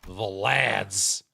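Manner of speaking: ordinary delivery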